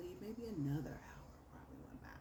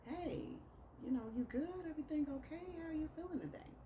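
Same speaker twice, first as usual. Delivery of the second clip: high, sing-song concern, a lot higher; overly sweet check-in; 14 semitones higher, faster than usual. Retold or imitated. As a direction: lay the concern on sweetly, pitched way up. Sweet check-in text voiced aloud.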